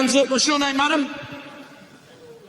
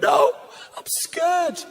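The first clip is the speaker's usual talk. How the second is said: lightly mimicked upset little boy, higher on the wail; retold child's wail; crying five-year-old; 8 semitones higher, much slower than usual. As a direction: imitate a scared child blurting it out. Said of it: "he was crying his eyes out".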